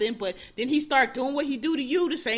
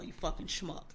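whispered insult; 5 semitones lower, 11 dB quieter than usual. Real, not acted. Muttered insult ending rant.

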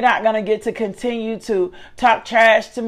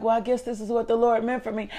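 resigned, much softer, giving up; fatalistic sigh; 9 dB quieter than usual. Retold or imitated. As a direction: mutter it like someone who has given up on everything. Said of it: "attitude like".

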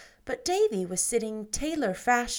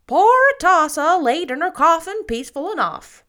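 much louder, higher gossiping matron, solemn; solemn Mrs Lynde; 8 semitones higher, 12 dB louder than usual. Acted. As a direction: announce it like a solemn village gossip. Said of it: "said Missus Lynde solemnly".

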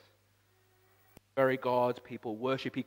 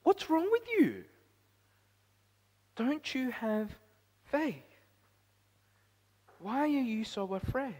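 much higher, stern mock rebuke; rebuking Jesus; 10 semitones higher than usual. Jesus scolding the disciples in the storm, lightly acted stern rebuke. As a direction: voice Jesus scolding his frightened men. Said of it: "He says".

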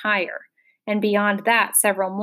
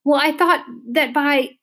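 higher, flustered attempt; pleading, raised-pitch boy; desperate; 6 semitones higher, 4 dB louder than usual. A narrator's reading. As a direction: try desperately to explain yourself. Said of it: "he tried again desperately".

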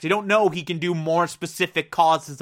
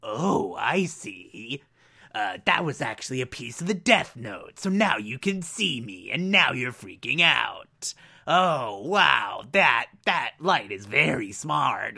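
lightly voiced Ryuk; 5 semitones lower, 4 dB quieter than usual. Ryuk explains calmly.

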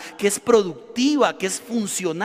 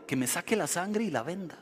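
quiet puzzled repeat; 3 semitones lower, 10 dB quieter than usual. Retold or imitated. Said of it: "la pregunta que me hago".

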